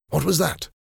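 high-pitched, alert question; startled question. Acted. Lord Antony to his friend on hearing a noise, startled.

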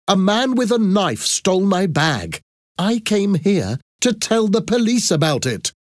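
high, indignant self-defence; angry protest. A narrator's reading. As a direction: argue your innocence hotly.

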